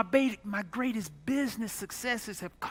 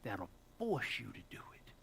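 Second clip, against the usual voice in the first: lower, calm voice of God fading to a whisper; 6 semitones lower, 5 dB quieter than usual. Retold or imitated. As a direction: speak as God, sinking low and ending in a whisper. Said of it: "And God would say".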